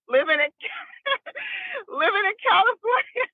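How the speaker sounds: laughing it out, voice jumping high. Real, not acted. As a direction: laugh through the words. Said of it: "laughing while saying it".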